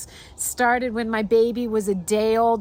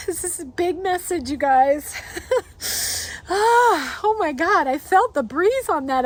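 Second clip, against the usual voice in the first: excited laughing; 6 semitones higher than usual. Real, not acted. Hawk flies close, she reacts.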